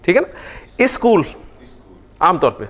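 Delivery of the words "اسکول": اسکول said with a leading i, iskool; اسکول with prothetic i; Karachi pronunciation. Shown as a demonstration. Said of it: "Karachi میں کیا پروناؤنس کرتے ہیں".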